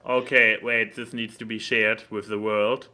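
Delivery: usual speaking voice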